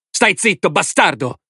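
high, angry cry; shouting. A narrator's reading. Woman shouts at man to shut up, furious. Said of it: "gridò la donna".

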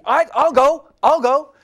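high, loud eager volunteering; eager wife. Retold or imitated. Wife eagerly volunteering herself, high and loud. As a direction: jump in fast like an eager wife. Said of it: "my wife immediately".

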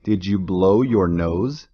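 ordinary talk, nothing marked

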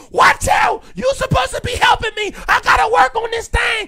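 high, loud panicked shout at people; shrieking high at the helpers, all panic; frantic yelling. Retold or imitated. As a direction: yell at everyone in a frantic rush. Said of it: "I'm yelling at people".

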